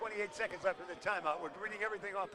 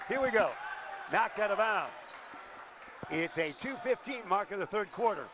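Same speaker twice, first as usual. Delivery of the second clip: play-by-play; 4 dB louder than usual. Acted. Live play-by-play.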